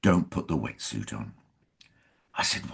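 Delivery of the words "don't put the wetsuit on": low, softened quote of a friend's tip; confiding. Retold or imitated. Co-star advises actor before a wet scene, confiding. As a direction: quietly slip them the advice. Co-star's confidential advice retold.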